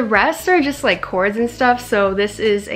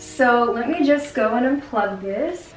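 ordinary delivery

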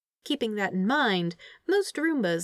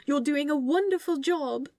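higher, doting praise for a pet; cooing praise; 8 semitones higher than usual. A narrator's reading. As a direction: coo at the machine like a proud parent. Angel praising the Roomba.

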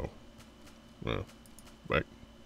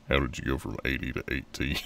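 normal talking voice

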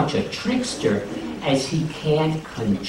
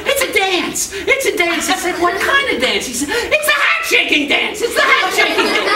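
pained squeaky Anansi; 3 dB louder than usual. Acted. Anansi in pain, much higher.

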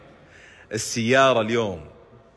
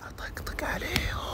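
higher, hushed whisper; 13 semitones higher, 12 dB quieter than usual. Real, not acted. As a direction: sneak the words out. Aside that he is teasing them.

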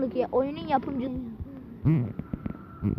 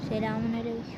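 lower, quieter put-on greeting; disguised deep voice; 5 semitones lower, 10 dB quieter than usual. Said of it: "Arkadaşlar sesimi değiştirdim".

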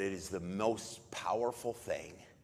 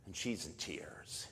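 hushed; 4 semitones lower, 9 dB quieter, slower than usual. Real, not acted. Mary arrives weeping.

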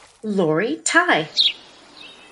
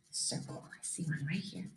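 much softer, almost whispered; hushed; 6 semitones lower, 19 dB quieter than usual. Real, not acted. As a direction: drop to a near whisper as you point. Hushed aside over book.

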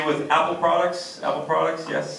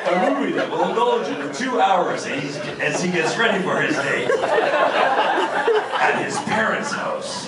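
high, slow dramatic trailer voice; movie trailer voice; 5 semitones higher, slower than usual. Acted. Comedian spoofing a movie trailer, slow and high. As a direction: announce it like a movie trailer narrator.

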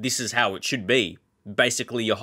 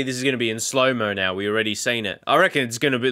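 usual speaking voice

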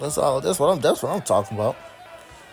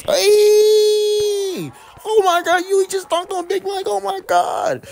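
excited yell; 6 dB louder, much slower than usual. Real, not acted. Teammate dunks on Big Mike.

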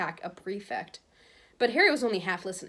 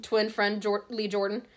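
plain reading voice

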